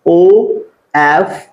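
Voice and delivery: letters spelled slowly one by one; slow spelling out. Shown as a demonstration. Teacher spelling the word for students, slow and clear. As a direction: spell out each letter, drawn out. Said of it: "जैसे वर्ड आपका".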